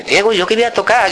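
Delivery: put-on timid young self, pitch up; bashful teen asking to play. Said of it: "yo muy corto".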